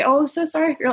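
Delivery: mock the saccharine condolence; mock sugarcoating. Mocking the sugarcoated sympathy she refuses to give. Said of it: "I don't sugarcoat it".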